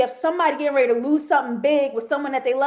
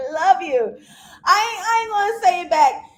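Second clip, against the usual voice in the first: high, laughing, teary tease; 8 semitones higher, 5 dB louder than usual. Retold or imitated. Host teasing about not saying it back, laughing hard. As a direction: cackle through the refusal.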